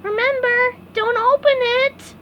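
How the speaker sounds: high call after someone; shouting after. A narrator's reading. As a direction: call out to a friend running off. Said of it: "shouted after him".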